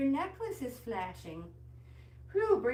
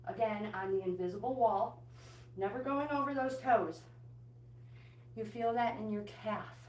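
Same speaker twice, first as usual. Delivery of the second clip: ordinary talk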